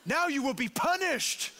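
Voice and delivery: hammed-up, high indignant tirade; entitled outrage. Entitled customer scolding the worker over an order, mock outrage. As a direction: rise into a mock-furious decree of punishment. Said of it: "It was a sense of entitlement".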